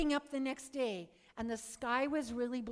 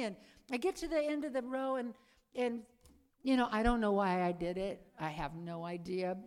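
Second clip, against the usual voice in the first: ordinary talk, nothing marked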